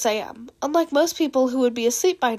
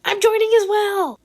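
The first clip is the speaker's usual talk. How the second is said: high, bright-eyed eager join; eager high chirp; 9 semitones higher, 4 dB louder than usual. Acted. Mei eagerly joining the midnight Uno game, high and keen. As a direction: jump in keenly, pitched up. Mastermind's chat line voiced.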